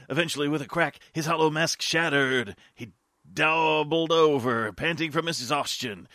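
ordinary talk

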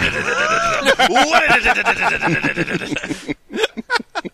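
long rising giggle that climbs high; high laugh. Real, not acted. Laughing at her friend's smutty reading. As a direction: crack up at the absurd text.

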